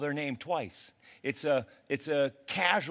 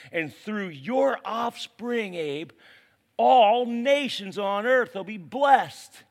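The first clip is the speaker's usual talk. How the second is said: slow, higher and louder declaration; rising proclamation; 8 semitones higher, 7 dB louder, slower than usual. Acted. God blessing Abraham's offspring, slow booming proclamation. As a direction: proclaim it to the whole earth. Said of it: "God declares his blessing".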